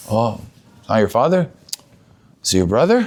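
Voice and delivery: low, tentative questions rising at the end; hushed-toned, cautious asking; puzzled gentle questioning. Acted. Puzzled consoler questioning the crying man.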